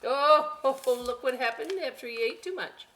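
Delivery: usual speaking voice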